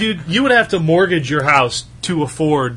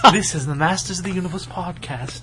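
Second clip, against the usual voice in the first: hushed accent impression, very soft; mock a podcast intro in a whispery put-on accent; [name]; 13 dB quieter than usual. Podcast host announcing his show, whispering accent impression. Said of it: "Why are you whispering?".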